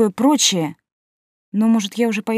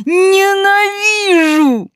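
drawn-out crying wail, much higher; wailing sob; 12 semitones higher, 5 dB louder, much slower than usual. Acted. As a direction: howl it through sobs. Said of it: "рыдала я со вкусом".

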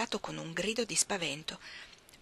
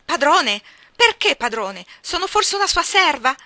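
much louder, much higher retort; indignant defiance; 8 semitones higher, 13 dB louder than usual. Acted. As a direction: throw the word back at her. Jane defies the maid. (ribattere indignata, molto forte e alta)

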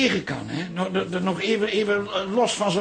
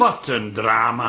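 slow, low, sarcastic sigh of a line; lower, slow deadpan; dry mock sorrow; 9 semitones lower, slower than usual. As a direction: drop it low and drawn out. Mocking the cancelled play.

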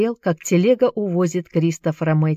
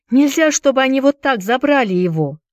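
high, protesting woman in a crowd; woman's voice; 8 semitones higher, 4 dB louder than usual. A narrator's reading. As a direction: cry out in protest as a woman, pitched up. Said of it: "послышался женский голос".

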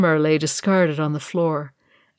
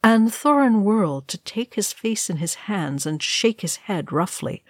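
ordinary delivery

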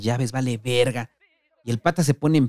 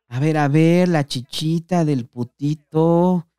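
higher, louder, very slow put-on taunt; mocking taunt; 5 semitones higher, 4 dB louder, much slower than usual. The patas groping Dani's shirt, slow leering taunt. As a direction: drag it out to humiliate.

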